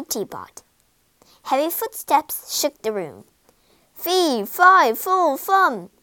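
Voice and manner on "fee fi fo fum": loud giant's chant, pitched up; rumbling bellow. A narrator's reading. Giant enters sniffing for prey, booming bellow. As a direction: bellow each word like a stomping giant. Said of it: "bellowed a rumbling voice".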